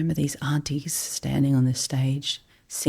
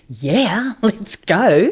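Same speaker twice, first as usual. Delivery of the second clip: eager yes; 6 semitones higher, 5 dB louder, much slower than usual. Retold or imitated. Accepting the whale watching invite.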